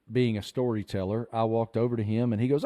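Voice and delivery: usual speaking voice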